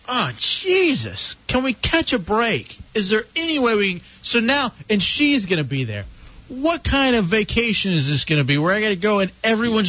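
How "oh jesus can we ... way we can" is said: pitched up, pleading, exasperated. Retold or imitated. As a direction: beg for a break, exasperated. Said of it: "And so I'm like".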